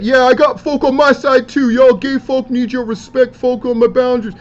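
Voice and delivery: high, loud retort as if posted in anger; mock indignant rant. Vlogger reads wrestler's defiant post aloud, mock indignant rant.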